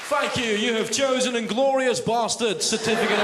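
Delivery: high, oblivious recorded confirmation; automated booking line. Acted. Booking line mishears the curse, high mock automated confirmation. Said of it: "the automated cinema ticket booking line".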